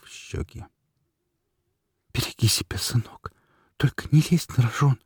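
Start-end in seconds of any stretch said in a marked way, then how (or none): 2.1-5.0 shaky whisper from a mother; trembling whisper. A narrator's reading. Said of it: "дрожащим голосом прошептала мать на ухо".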